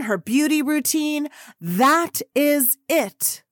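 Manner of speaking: high, slow, deliberate address; stern publicist announcement. Retold or imitated. Publicist addresses waiting reporters before interviews, stern announcement. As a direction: lay down the rules, word by word.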